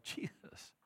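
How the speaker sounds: breathy unvoiced "Jesus" in disbelief; exasperated whisper. Disciples whispering to Jesus in disbelief. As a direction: whisper his name, exasperated.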